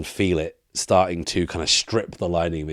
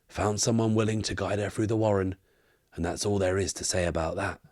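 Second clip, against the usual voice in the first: put-on elderly barkeep; 4 semitones higher than usual. Barkeep answers questions about a woman, elderly barkeep voice. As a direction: play a world-worn old bar owner.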